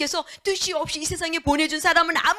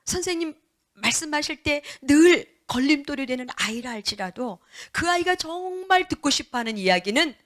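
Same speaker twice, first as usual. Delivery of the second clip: normal talking voice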